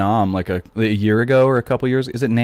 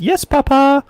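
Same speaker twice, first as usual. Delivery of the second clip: put-on high, sweet child's reply; obedient little boy; slower than usual. French kid answering his papa, sweet and obedient. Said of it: "came skipping over and said".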